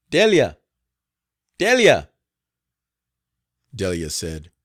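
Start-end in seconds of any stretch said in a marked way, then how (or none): 0.1-2.1 loud, high call across the house. A narrator's reading. Madam calls the cook from the house, loud and high. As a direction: shout her name toward the kitchen.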